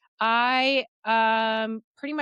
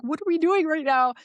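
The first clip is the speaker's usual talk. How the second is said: exasperated hush yell; 8 semitones higher, 4 dB louder, faster than usual. Retold or imitated. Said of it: "still hush yelling at him".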